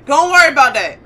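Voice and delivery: loud nervous brush-off. Real, not acted. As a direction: say it again, firmer and loud. Repeats it, louder.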